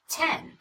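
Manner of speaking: "Ten" shorter, still a high pitch glide; sped-up pitch change. Shown as a demonstration. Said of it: "Even when I speed it up, that change in pitch is obvious".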